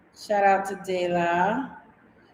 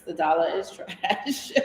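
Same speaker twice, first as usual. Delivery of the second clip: laughing through the words; en riant; 4 dB quieter than usual. Real, not acted. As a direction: chuckle while repeating it. Repeats comment laughing.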